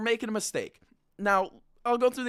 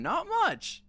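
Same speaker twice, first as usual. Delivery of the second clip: mocking high; 11 semitones higher than usual. Real, not acted. Mocking sad Leafs fans.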